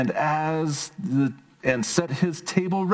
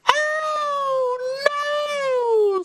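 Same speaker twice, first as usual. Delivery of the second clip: very high crying fly; tiny squeaky wail. Acted. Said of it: "said the little fly".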